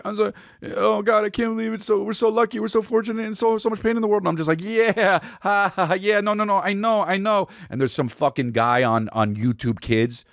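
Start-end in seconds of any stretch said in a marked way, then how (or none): 0.1-4.2 mock tearful, high-pitched babble; high, hammed-up weepy voice; imitated crying. 4.6-7.5 high, strained nervous reassurance; high yeah-yeah with forced ha-has; mock placating. Placating his crying partner.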